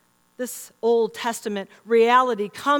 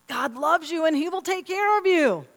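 higher, lightly raised calling voice; dad calling out; 6 semitones higher than usual. Retold or imitated. Husband repeats his reassurance to daughter, mild imitated call. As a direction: call it out to a child in another room. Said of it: "Tony gave the same response".

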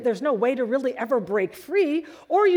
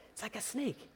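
hushed repeat; 14 dB quieter than usual. Acted. Blind man repeats.

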